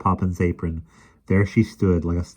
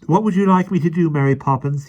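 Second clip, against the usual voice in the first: put-on eager boy; 10 semitones higher, 5 dB louder than usual. A narrator's reading. Michael eagerly asking Mary.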